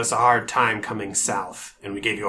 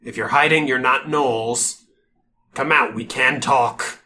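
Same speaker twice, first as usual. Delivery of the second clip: calling out; 6 dB louder than usual. Acted. GM voicing orc guard.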